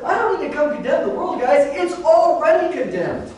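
Jesus speaking, pitched high and earnest; voicing Jesus. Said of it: "Jesus says".

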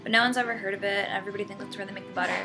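ordinary delivery

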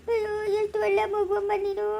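put-on moved to tears by the child; tearful grandmother. (ಕಣ್ಣೀರಿನ ಅಜ್ಜಿ)

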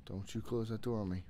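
usual speaking voice

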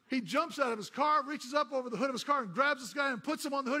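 loud, high, building preacher delivery; full-force sermon storytelling; preaching at full force. Acted.

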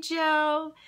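ordinary talk, nothing marked

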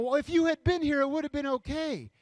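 loud, high-pitched frantic outcry; hammed-up distraught complaint; frantic Mary. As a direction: rush in wailing a reproach. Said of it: "Mary in this story who rushes out".

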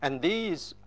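ordinary talk

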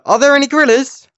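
"gorillas" pitched high as a question; demonstrated question delivery. Shown as a demonstration. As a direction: ask "are there any gorillas" high and lively. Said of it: "Like that".

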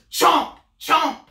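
high, loud chomp sounds. Acted. Wolf gobbling Granny loudly. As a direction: bite down hard.

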